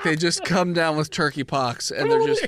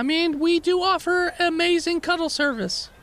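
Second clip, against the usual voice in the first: high innkeeper voice; 11 semitones higher than usual. Acted. Innkeeper pitches service.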